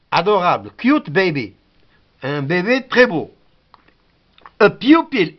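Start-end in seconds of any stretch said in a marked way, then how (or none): 4.6-5.4 "pupil" higher, starting pyoo; u as you. Shown as a demonstration. Teacher showing here it is you, glided u. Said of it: "Ici, c'est you".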